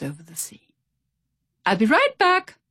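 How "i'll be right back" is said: much higher, louder call. A narrator's reading. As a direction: call it back over his shoulder. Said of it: "called Pip".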